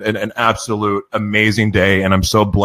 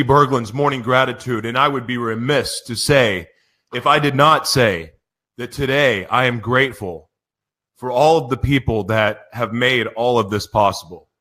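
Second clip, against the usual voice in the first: ordinary talk, nothing marked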